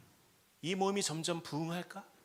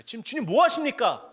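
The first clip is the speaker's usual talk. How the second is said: demanding God; 6 semitones higher, 11 dB louder than usual. Acted.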